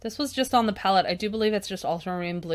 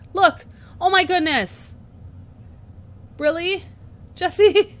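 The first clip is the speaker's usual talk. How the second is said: high, loud cries of dismay; shocked exclamation; 10 semitones higher, 10 dB louder, slower than usual. Real, not acted. Vlogger to viewers, spotting her head blocks the shot, shocked outcry. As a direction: gasp out loud at the ruined footage. Sees blurry footage, shocked.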